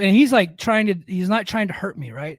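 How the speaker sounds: ordinary talk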